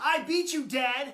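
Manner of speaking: usual speaking voice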